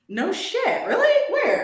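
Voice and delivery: high, loud sarcastic disbelief; squealed mock astonishment; mock surprise. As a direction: fake shock, dripping with sarcasm.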